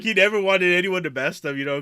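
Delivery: normal talking voice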